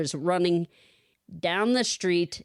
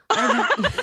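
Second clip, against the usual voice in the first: laughing; 4 dB louder than usual. Real, not acted. Incredulous burst into laughter.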